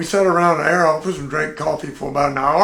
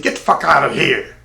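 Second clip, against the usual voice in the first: louder, harsher voice ordering him away; mock brush-off; 7 dB louder than usual. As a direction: shoo the kid off with a growl. Tank dismisses the airman.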